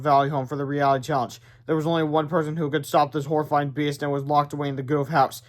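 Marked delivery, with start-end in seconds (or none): none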